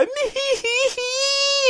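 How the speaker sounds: very high whiny fake wail; mock crying. Dad mimicking child crying over a toy, mock whine. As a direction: imitate a child's drawn-out stuttering sob.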